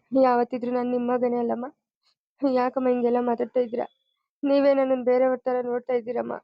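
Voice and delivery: ordinary talk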